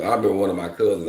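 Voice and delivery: usual speaking voice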